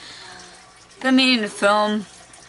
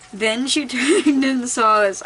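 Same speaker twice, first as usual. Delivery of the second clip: giggling while trying to read on; real laughter; 4 semitones higher than usual. Real, not acted. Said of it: "I don't know why I'm laughing at this".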